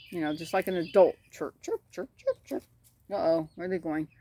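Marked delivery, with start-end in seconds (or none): 1.3-2.6 high, soft, quick chirping; hen chirps. Retold or imitated. Keeper showing how she chirps like an adult, high. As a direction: imitate a grown hen's chirps. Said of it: "she chirp chirps".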